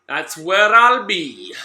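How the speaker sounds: That's where I'll be drawn out slow and loud; stretched call. Shown as a demonstration. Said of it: "then that's where I'll be".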